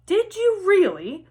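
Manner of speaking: high, thrilled question; can't hide his delight; eager. Acted. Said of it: "said Mister Weasley eagerly".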